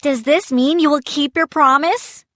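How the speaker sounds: high, loud demand. A narrator's reading. Boy demands the pirate keep his word, loud and high. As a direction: press him hard on it. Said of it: "I demanded".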